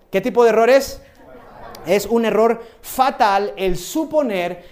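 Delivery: preacher reading scripture to the congregation; solemn reading. Acted. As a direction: read each word as a grave warning. Reads aloud from a book.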